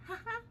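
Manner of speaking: soft, very high giggle; imitated ghostly little-girl laugh; imitated child's giggle. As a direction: giggle lightly, high and small. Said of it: "like, a giggle".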